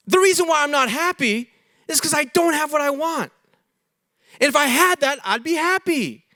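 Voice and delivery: high, lightly voiced self-talk, pleading for the thing you want; sing-song wishful inner voice; a hint of a hopeful child insisting; naive inner voice. Voicing the self-deceived wanter.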